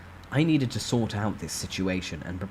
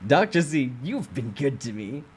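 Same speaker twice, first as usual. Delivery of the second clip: veiled threat; 5 semitones higher, 4 dB louder than usual. Acted. Boss threatens.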